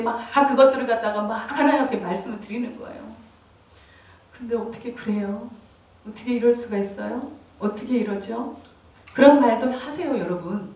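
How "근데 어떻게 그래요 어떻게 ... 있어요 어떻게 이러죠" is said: hushed, questioning protest to God; quiet complaint. Retold or imitated. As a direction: complain under your breath in prayer. (작은 소리로 하나님께 하소연하듯)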